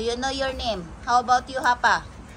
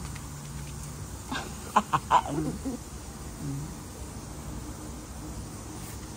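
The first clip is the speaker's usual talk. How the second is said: breathy laugh; 7 semitones lower, 6 dB quieter than usual. Real, not acted. Laughs at the pet.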